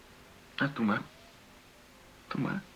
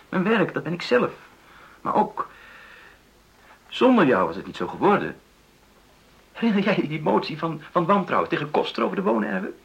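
loud, high and urgent; heated, pleading insistence; raised voice; 7 semitones higher, 5 dB louder than usual. Acted. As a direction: press the point, voice lifted. Impassioned plea to partner.

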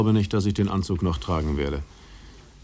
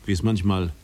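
ordinary delivery

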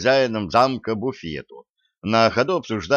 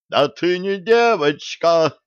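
high, slow roar of rage; 8 semitones higher, 4 dB louder, slower than usual. A narrator's reading. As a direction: roar it at the impostor. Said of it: "рёв бабушки".

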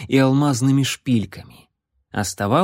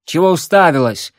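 snapping; 4 semitones higher, 5 dB louder than usual. Acted. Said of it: "огрызнулся Леон".

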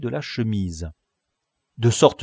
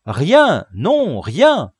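loud, high negatives falling away; emphatic lookout's report; calling down; 6 semitones higher, 8 dB louder, much slower than usual. A narrator's reading. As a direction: call down each word separately. Lookout calls down from tree.

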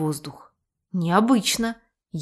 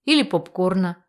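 usual speaking voice

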